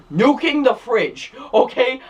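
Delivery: high, slow, incredulous nuking the fridge; exasperated emphasis. Real, not acted. Reviewer scoffing at an absurd movie moment, exasperated and drawn out. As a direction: drag it out in disbelief. Ranting about absurd scene.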